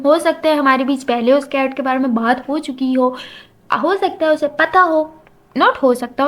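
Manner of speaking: usual speaking voice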